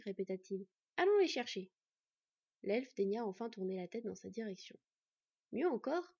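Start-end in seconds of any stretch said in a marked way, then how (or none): 1.0-1.7 urging. Acted. Boy urging brother.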